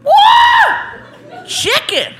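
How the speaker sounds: high, shrieked-out excitement; excited. Acted. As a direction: exclaim it like a thrilled man unpacking a gift. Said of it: "He is getting more and more excited".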